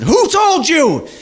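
mock-furious confrontation, each word stretched out; furious demand. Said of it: "she got mad and she turned around".

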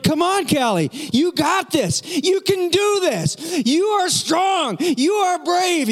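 high, slow cheering holler; yelled encouragement. Retold or imitated. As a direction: yell each phrase to push the child on. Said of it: "now I'm yelling louder than that".

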